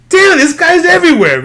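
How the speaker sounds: high, loud exasperated outburst. Retold or imitated. Fan retelling their reaction to the villain, exasperated. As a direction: re-enact fed-up shouting at the screen.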